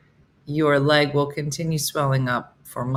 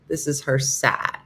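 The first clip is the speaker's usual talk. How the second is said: normal talking voice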